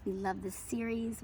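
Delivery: normal talking voice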